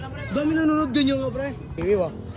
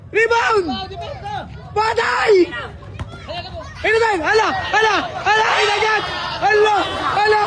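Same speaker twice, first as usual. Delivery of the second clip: frantic courtside exclaiming; alarmed shouting; 5 semitones higher than usual. Real, not acted. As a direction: yell out in rising alarm, high-pitched. Alarmed commentary as fight restarts.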